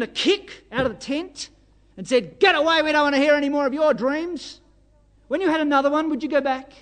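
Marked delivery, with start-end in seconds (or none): 2.4-4.6 loud, raised voice of fed-up brothers; irritated rebuff. Retold or imitated. Brothers sending the dreamer out of the tent, irritated rebuff. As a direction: imitate a loud, annoyed dismissal.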